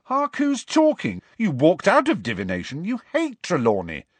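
high-pitched indignant retort. A narrator's reading. Friend throws Hermione's walkout back at her, indignant. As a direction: protest in outrage, pitch jumping up. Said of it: "said Ron indignantly".

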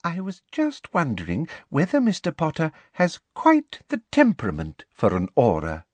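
high, falsely sweet voice; sugary needling; sweetly. A narrator's reading. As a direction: plant a doubt sweetly. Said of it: "said Professor Umbridge sweetly".

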